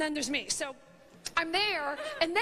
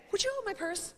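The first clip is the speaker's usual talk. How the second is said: breezy, offhand request that sounds higher; mock casual ask; 4 semitones higher than usual. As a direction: toss it off like it's nothing. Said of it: "I will just casually say".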